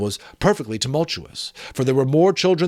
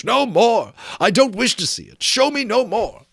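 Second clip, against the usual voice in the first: louder, higher cry of no more; anguished cry; 11 semitones higher, 4 dB louder than usual. A narrator's reading. Scrooge crying out to the Ghost, anguished. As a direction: cry out in torment. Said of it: "cried Scrooge".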